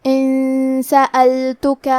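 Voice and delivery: ان سألتک with the ن hidden as a nasal hum before س, very slow; ikhfa. Shown as a demonstration. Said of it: "غنہ کے ساتھ ادا کریں گے".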